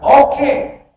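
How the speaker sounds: high, punchy cocky reply; defiant Iblis. Retold or imitated. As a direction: answer back with brazen unconcern. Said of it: "Namanya Iblis. Kata".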